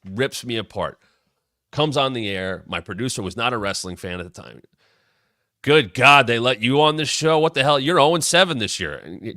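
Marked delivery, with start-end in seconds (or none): 5.6-8.9 loud, higher heel heckling, imitated; Memphis heel taunt. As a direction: tear into the host like a wrestling villain. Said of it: "comes on as Memphis heel Jeff Jarrett".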